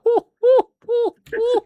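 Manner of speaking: very high, loud hooting whoos; excited whooping. Retold or imitated. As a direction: hoot like a crowd losing it. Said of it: "I'm like".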